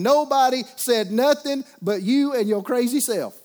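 slow, loud and high, hammering each word; preacher leaning hard into the punchline; emphatic preaching punch. Real, not acted. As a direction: drive home the crazy self. Pastor mocks self-argument.

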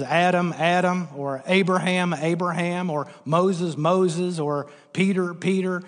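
ordinary delivery

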